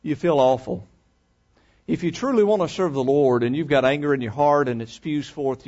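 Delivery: ordinary talk, nothing marked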